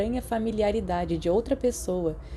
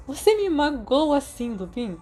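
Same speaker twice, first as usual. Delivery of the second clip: high mock-wounded whine; mock hurt; 7 semitones higher than usual. Sirius pretending Remus hurt his feelings, mock wounded. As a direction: play the injured party, hammed up.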